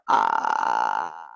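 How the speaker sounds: long, very low creaky "ah"; sustained low croak; vocal fry. Shown as a demonstration. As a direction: let the voice rattle on a held ah. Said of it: "So it's called a vocal fry".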